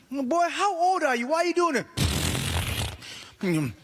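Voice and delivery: sudden high yelp, then a slow dragged question; shocked outburst. Real, not acted. Yelling at son at home.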